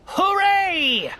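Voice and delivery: high triumphant cry of a small turtle king; shouted hooray. A narrator's reading. King turtle cheering atop his stack, high triumphant shout. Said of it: "shouted Yertle".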